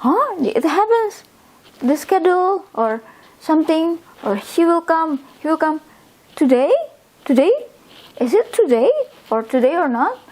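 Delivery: a high, puzzled "Huh?" then quick eager questions; surprised question. Pretend. Teacher acting a confused colleague checking the schedule, surprised. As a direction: sound suddenly unsure whether it's today. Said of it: "then we want to say like".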